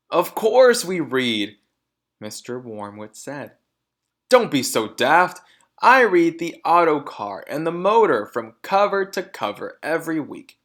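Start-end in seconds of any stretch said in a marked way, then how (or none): 0.1-1.6 loud, slow, huffy insistence; offended loud father; indignant. A narrator's reading. Mr Wormwood indignant. 4.3-10.6 loud, contemptuous dad voice; boastful loud father; scoffing. A narrator's reading. Mr Wormwood scoffing.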